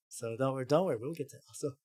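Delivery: usual speaking voice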